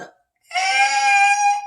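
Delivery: long, loud high-pitched "ehhh" like an alarm. Retold or imitated. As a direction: blare out a piercing held "eh". Body imitated as alarm going off.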